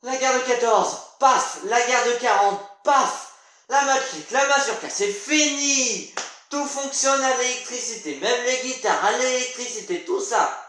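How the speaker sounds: exasperated stage character lecturing with bursts of command; brusque revival doctor. Acted. Doctor to man thawed after sixty-five years, brusque and exasperated. Same character recaps history.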